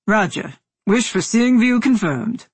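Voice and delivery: brisk controller at normal pitch; comm officer voice. A narrator's reading. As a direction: confirm it crisply like mission control.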